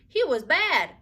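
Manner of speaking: put-on high, loud defiant child voice. A narrator's reading. Ramona defiantly answering her mother. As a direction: fire it back like a stubborn toddler. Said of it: "said Ramona defiantly".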